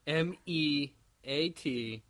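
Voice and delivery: very slow, letter by letter "M E A T"; spelled out. Shown as a demonstration. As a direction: spell each letter of M E A T apart. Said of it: "spelling out the site name".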